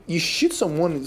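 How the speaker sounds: ordinary delivery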